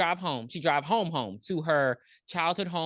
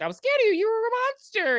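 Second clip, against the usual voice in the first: much higher, louder cry at mom; high frightened accusation; 5 dB louder than usual. Retold or imitated. Rose confronts her mother, high childlike accusation.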